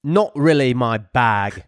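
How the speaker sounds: slow, put-on English accent; attempted English accent. Shown as a demonstration. Co-host showing off for British guest, attempted English accent. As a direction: draw it out in an amateur English accent. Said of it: "Rob, speak in your English accent".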